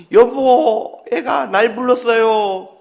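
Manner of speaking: overjoyed mother with a laugh; thrilled mother. Acted. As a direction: gush to your husband that the baby spoke. Mother thrilled baby called her.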